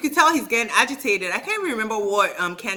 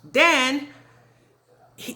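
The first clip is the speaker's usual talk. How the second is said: ordinary talk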